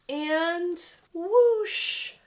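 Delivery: high, loud squeal of delight; joyful whoosh. Acted. The machine flies at last, loud joyful squeal. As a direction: cheer it as it soars.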